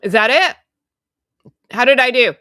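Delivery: loud, high-pitched check; calling out. Real, not acted. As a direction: call out the questions, loud and high. Calls out to check tech worked.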